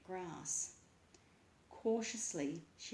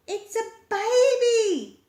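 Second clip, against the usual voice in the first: much higher, much louder, slow; excited call; 19 dB louder, slower than usual. A narrator's reading. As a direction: call out the discovery. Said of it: "she called back".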